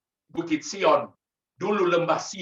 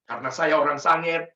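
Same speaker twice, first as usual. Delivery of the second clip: normal talking voice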